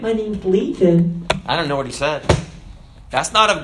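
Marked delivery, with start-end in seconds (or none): 0.0-1.3 slow, high-pitched effeminate put-on voice. Demon telling Jesus its name, effeminate. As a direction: draw it out in a mock effeminate lilt. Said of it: "He might have talked all effeminate".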